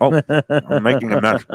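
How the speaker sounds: talking with a mouth full of food. Real, not acted. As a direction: speak through a bite of salad. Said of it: "critique with my mouth full".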